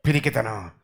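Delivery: పిరికితనం low and soft; quiet. Real, not acted. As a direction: murmur it. Word soft. (మెల్లగా)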